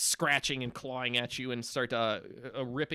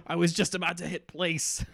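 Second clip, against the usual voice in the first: dismayed cry; 5 semitones higher, 7 dB louder than usual. Acted. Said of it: "so he's like".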